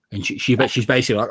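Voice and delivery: ordinary talk, nothing marked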